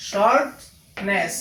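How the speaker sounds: slow "shortness", split in two; syllable by syllable. Shown as a demonstration. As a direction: break "shortness" into short, pause, নেস. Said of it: "এনে syllable কয়টা". ("Shortness" ধীরে দুই ভাগে ভেঙে)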